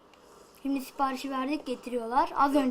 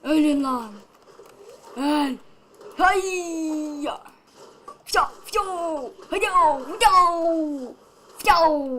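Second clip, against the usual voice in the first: louder fighting shouts; battle yells; 3 semitones higher, 6 dB louder, slower than usual. Acted. Gamer beating enemies, shouting taunts and battle yells. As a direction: yell at the enemies with each blow.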